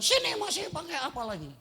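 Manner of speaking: high, impatient mock snap; hammed-up annoyed spouse; mock angry. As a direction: spouse grumbling while getting dressed. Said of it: "sambil marah saudara".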